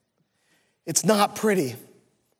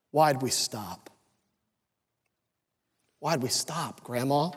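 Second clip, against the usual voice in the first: soft sad question; 6 semitones lower, 7 dB quieter, slower than usual. Acted. Girl asks grandmother softly.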